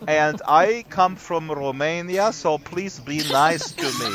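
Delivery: mock Romanian accent, slow; hammed-up Romanian accent; weird Romanian accent. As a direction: play the shy Romanian intern in a mock Romanian accent. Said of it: "do this entire show in this weird accent".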